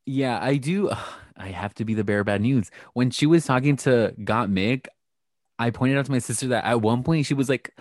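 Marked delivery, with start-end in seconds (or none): none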